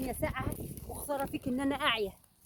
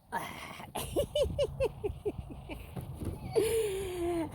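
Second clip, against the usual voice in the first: wheezing laugh that breaks high; helpless laughter at the video; 5 semitones higher than usual. Real, not acted. Laughing helplessly at a woman who married herself.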